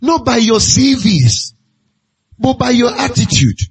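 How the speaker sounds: preaching. Acted. Preaching over music.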